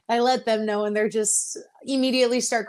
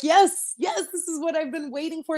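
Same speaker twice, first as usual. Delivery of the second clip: higher, triumphant yes to herself; excited self-talk, pitched up; retold elation; 6 semitones higher than usual. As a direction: relive the moment you finally made it. Reliving her moment of triumph.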